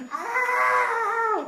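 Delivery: long, high, loud scream; piercingly high imitated shriek; mock terrified scream. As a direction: imitate frightened kids screaming. Kids scream at mummy.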